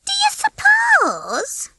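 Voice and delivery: very high, drawn-out hen voice; musing. A narrator's reading. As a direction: wonder slowly in a high little voice. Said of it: "Babs mused".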